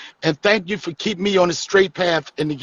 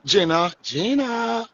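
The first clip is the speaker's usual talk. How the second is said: drawn-out, higher coaxing call; 4 semitones higher, slower than usual. Real, not acted. Host calling Gina back into the conversation, coaxing. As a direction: stretch the name out slowly to get attention.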